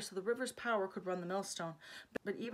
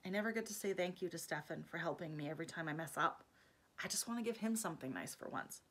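soft sad confession; 3 semitones lower, 8 dB quieter than usual. Acted. Alex sadly explaining herself.

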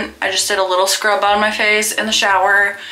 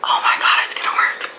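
whispering. Real, not acted. Excited at the freckles working.

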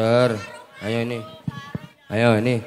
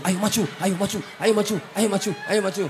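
higher, coaxing mock urging; imitating pushy big brother; 8 semitones higher, faster than usual. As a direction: mimic the brother egging him on. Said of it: "Adike disurung-surung". (menirukan kakak yang menyuruh maju)

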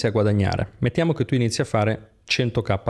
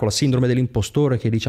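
ordinary delivery

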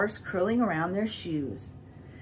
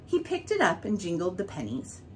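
ordinary delivery, nothing marked

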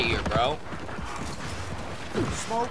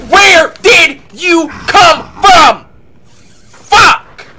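startled yell; 9 semitones higher, 9 dB louder, much slower than usual. Real, not acted. Ambushed again in game.

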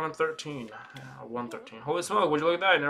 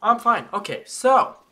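forced cheer; 10 semitones higher, 8 dB louder than usual. Real, not acted. Clearly not fine.

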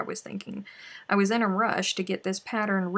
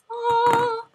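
high, loud burst of delight; much higher, louder joyful exclamation; delighted cry; 8 dB louder than usual. Real, not acted. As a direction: cry out at finishing. Finishing the piece.

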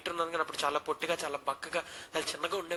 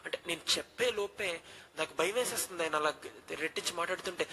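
usual speaking voice

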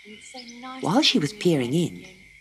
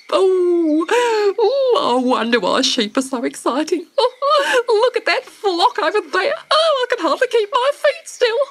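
high, laughing bird voice; giddy laughing. Acted. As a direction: chuckle with barely held excitement. Emu giddy about sheep.

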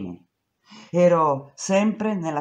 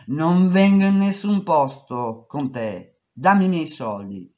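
angry refusal; 6 dB louder than usual. Retold or imitated.

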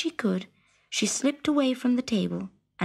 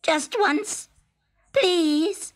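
begging frog; 7 semitones higher, much slower than usual. A narrator's reading.

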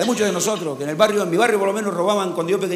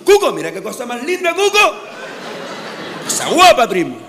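excited gasp; 4 semitones higher, 3 dB louder than usual. Retold or imitated. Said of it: "se estació y".

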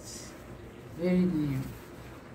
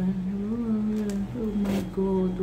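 usual speaking voice

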